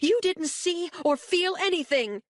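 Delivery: a high, desperate mother's cry; shouting. A narrator's reading. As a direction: shout it at your daughter in anguish. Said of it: "Janice shouts".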